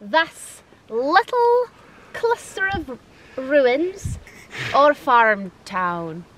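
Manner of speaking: slow, deliberate Scottish accent; careful, drawn-out stab at Scots. Shown as a demonstration. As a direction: attempt your best Scottish accent, word by word. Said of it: "do my best Scottish accent".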